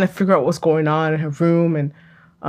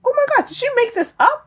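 much higher-pitched mock astonishment; retold shock. Viewer suspecting the sister was invented, retold shock. Acting out own shocked reaction.

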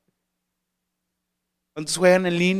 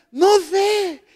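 put-on much higher, loud whiny teenager; clueless teen voice; 12 semitones higher, 7 dB louder than usual. Teen student answering the adult with a high whiny voice. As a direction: toss it off like a lost student.

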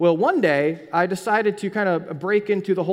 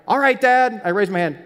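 blurted confession; 5 semitones higher, 8 dB louder than usual. Retold or imitated. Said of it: "And I go".

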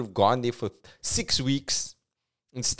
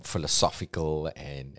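ordinary delivery

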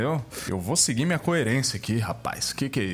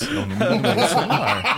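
laughing; 8 semitones higher, 3 dB louder than usual. Real, not acted. Joking bribe offer, laughing.

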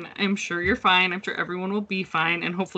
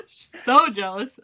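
slow, higher, laughing "so jealous"; laughing jealousy; 4 semitones higher, much slower than usual. Real, not acted. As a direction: tease with a laugh. Playful envy, laughing.

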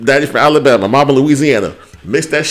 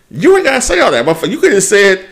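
heated rant; 8 semitones higher, 4 dB louder, faster than usual. Acted. Ranting at petty Toya.

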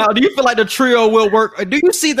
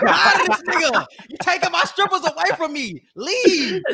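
higher, laughing mock Harden rant; 9 semitones higher than usual. Harden complaining about John Wall in the club, mock higher voice. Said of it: "James Harden was tired of seeing John Wall".

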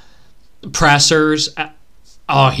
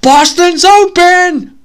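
loud, slow, higher call to be heard; eager announcing yell; 12 semitones higher, 8 dB louder, slower than usual. Retold or imitated. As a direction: shout the news across the room, drawing it out. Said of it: "somebody get this to him and say".

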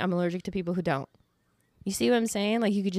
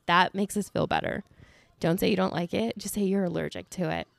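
ordinary delivery

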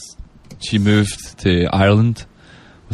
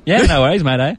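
broad, higher Australian reply; Aussie accent; 4 semitones higher than usual. Retold or imitated. Guest passing as Australian at work, broad Aussie accent. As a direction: lay on the Aussie mateyness. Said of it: "I actually talk Australian and work".

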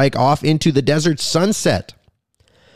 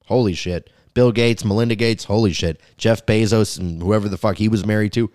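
ordinary talk, nothing marked

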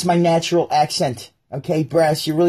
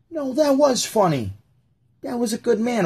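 beta defending the joke; 9 semitones higher than usual. Retold or imitated. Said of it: "one of the betas go".

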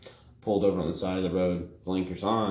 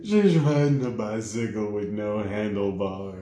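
mock sob punchline; slower than usual. Punchline in crying voice.

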